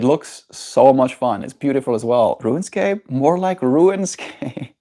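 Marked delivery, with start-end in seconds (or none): 2.4-4.7 mock pun voice. Pun delivered in put-on voice.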